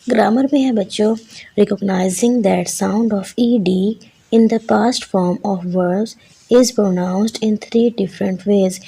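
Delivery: usual speaking voice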